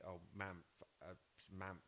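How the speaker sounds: put-on soft toddler "Mamph" for Samantha; a quiet child's "Mamph"; toddler mispronunciation. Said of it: "all I used to do was just say".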